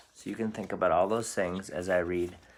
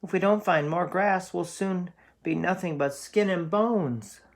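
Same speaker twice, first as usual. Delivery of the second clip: much higher, louder worried goat voice; fretful goat voice; nervous middle goat; 10 semitones higher, 4 dB louder than usual. Acted. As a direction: squeak it out like a nervous little goat. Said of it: "said Middle Billy Goat Gruff".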